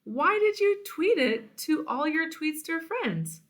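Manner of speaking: high, hurt accusation; the vulture confronting her friend over the photo, hurt and upset. Acted.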